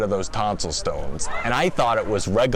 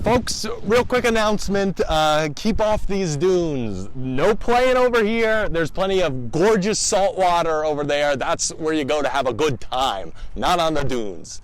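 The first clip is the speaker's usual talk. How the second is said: announcer; 7 semitones higher than usual. Acted. Lifeguard-style announcement to beachgoers.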